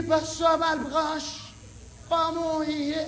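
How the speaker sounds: high, very slow put-on voice; imitated caller. Someone calls on Pastor Malbranche, imitated in a high slow voice. Acts out person addressing the pastor.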